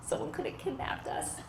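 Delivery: put-on kid's whispered guilt trip; whispered accusation. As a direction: hush it like a dark secret. Son's accusing remark.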